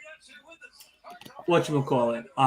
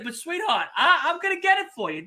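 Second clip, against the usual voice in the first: Wolf's pleading; 10 semitones higher, faster than usual. Pretend. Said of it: "Wolf said".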